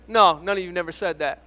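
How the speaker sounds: normal talking voice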